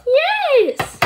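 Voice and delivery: high, loud pretend cheer; eager cheer. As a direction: answer like a pup whose turn has come. Sky called for her present.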